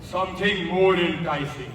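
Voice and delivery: ordinary talk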